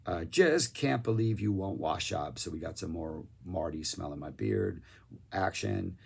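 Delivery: ordinary talk, nothing marked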